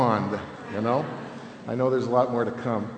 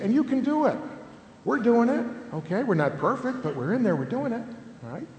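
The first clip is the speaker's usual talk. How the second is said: upbeat encouragement; 9 semitones higher than usual. Retold or imitated. Cheering families on.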